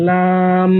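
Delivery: লাম with a long level aa; elongated madd. Shown as a demonstration. Teacher joining madd letter and sukun, long held লাম. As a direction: draw out লাম and close on m. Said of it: "মাদের হরফ লা".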